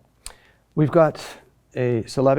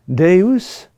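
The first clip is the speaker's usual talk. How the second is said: Deus louder and higher, long DE, rising; spondee stress; 4 semitones higher, 8 dB louder than usual. Shown as a demonstration. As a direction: punch the DE in Deus and lift the end.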